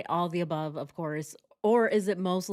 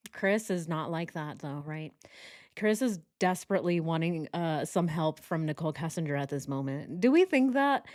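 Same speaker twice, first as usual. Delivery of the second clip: normal talking voice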